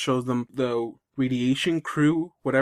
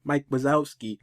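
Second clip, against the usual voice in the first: usual speaking voice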